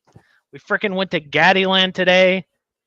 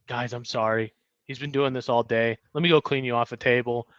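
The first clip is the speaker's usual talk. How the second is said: low, soft, weary-worker voice; subdued apology; 7 semitones lower, 8 dB quieter than usual. Retold or imitated. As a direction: imitate a worker apologizing under their breath. Retold worker apologizing to customers.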